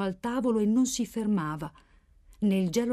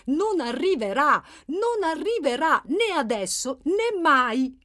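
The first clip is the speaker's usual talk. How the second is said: louder, high exasperated insistence; esasperazione; 7 semitones higher, 5 dB louder, slower than usual. A narrator's reading. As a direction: repeat it forcefully, exasperated at not being heard. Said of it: "lo ripeté più forte, con una punta di esasperazione". (più forte, con esasperazione nella voce)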